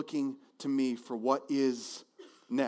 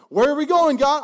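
high, loud anxious pestering; a kid pestering Dad from the back seat; whiny child in car; 12 semitones higher, 11 dB louder than usual. Pretend. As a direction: nag like a kid on a road trip. Abram voiced asking God.

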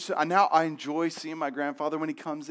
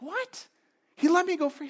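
high, incredulous squeak of surprise; a boy who can't believe his luck; a child's stunned delight; shocked disbelief; 13 semitones higher than usual. Retold or imitated. Son's shocked reaction in story.

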